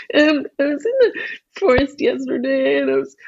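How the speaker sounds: high, fast whining; whiny princess. Acted. Princess whines her story to the king. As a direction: whimper and whine through it. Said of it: "So whiny".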